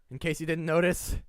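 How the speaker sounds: ordinary talk, nothing marked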